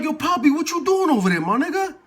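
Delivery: high, loud, half-laughing challenge; incredulous confrontation. Retold or imitated. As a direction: call him out in acted disbelief. Confronting the old man in cell.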